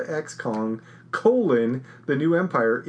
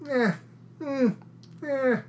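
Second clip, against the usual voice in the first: unimpressed meh; 9 semitones higher, 5 dB quieter, slower than usual. Shown as a demonstration. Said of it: "I left this with".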